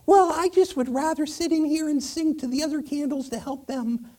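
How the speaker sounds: put-on very high girlish candle voice. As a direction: decline in a high, girly little voice. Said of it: "and she goes".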